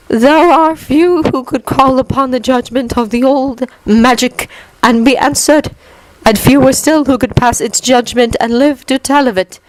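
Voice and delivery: high, otherworldly woman's voice; solemn wise Keeper. A narrator's reading. Keeper addressing the watching wizards, high solemn voice.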